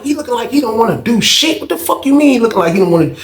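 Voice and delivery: high-pitched, disbelieving question; indignant questioning that speeds up at the end; incredulous echo. Retold or imitated. Incredulously echoing what he was told.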